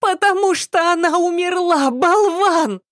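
very high-pitched, scolding maid voice; exasperated maid. A narrator's reading. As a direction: scold him high through a stuffy nose. Maid snaps at obtuse servant.

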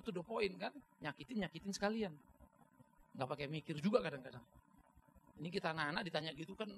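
ordinary delivery

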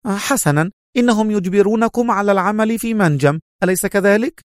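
high-pitched, firm woman's voice; acted female severity; stern. A narrator's reading. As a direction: put it to him firmly, voice high. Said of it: "وقالت في صرامة".

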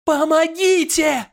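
high, desperate screaming for help; wild cry for help. Acted. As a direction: scream in terror, voice pitched high. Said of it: "повторился крик". (дикий крик о помощи)

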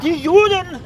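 high loud jolt awake; loud confused yelp; startled outcry. Acted. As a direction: jerk awake shouting.

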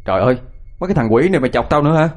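furious rebuke to mocking friends; angry shout. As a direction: yell at the teasing friends, hurried. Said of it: "Thành quát".

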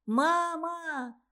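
put-on high child voice calling; child crying out. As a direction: call out for mum. Girl shouting after mother.

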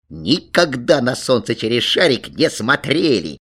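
ordinary delivery

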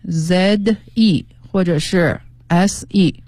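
slow, drawn-out letters; deliberate classroom spelling. Shown as a demonstration. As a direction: spell the verb slowly, letter by letter. Spelling the verb form aloud.

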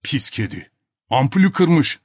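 put-on grumbling character voice, rising; Topak calling out. A narrator's reading. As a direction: complain to those outside the door. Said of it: "diye seslendi Topak". (söylenerek seslenme)

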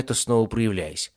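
usual speaking voice

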